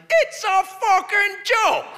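high, drawn-out comic shout; exasperated shout. Acted. Comic telling a crowd what Canadians realized, exasperated shout. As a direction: holler it slowly, high over the crowd.

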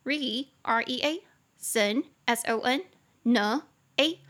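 very slow, letter by letter; spelling out syllables. Shown as a demonstration. Teacher spelling reasonable to students, very slow. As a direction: spell it out for the class slowly. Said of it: "四个音节".

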